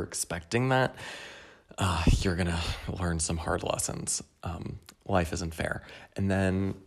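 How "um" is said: ordinary delivery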